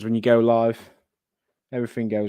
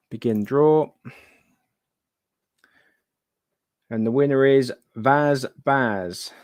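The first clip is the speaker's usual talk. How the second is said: hyped giveaway announcement; 3 dB louder, slower than usual. Acted.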